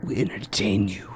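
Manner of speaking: slow, hushed ad announcer read; mock advertisement. Host jokingly reads an ad for his guest's family tours, as a slow ad announcer. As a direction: sell it like a late-night tour ad. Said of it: "So we're gonna do an advertisement right now".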